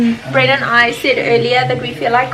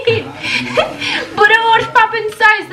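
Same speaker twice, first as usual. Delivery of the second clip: laughing; 8 semitones higher, faster than usual. Real, not acted. Laughing at the judge's remark.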